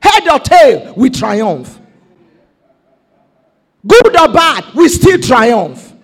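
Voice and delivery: loud, rising sermon shouts; preaching at full force. Real, not acted. Preacher to congregation, shouting promises of triumph. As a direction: whip the congregation up.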